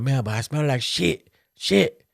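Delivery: a light take on a dad's voice, pitch rising; imitating dad. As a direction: play the dad who never cusses, now cussing happily. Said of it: "He's like".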